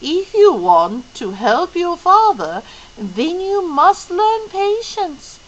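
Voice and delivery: put-on a wise old woman teaching patience; slow and serious; solemn Mrs Who. Mrs Who voiced as figure.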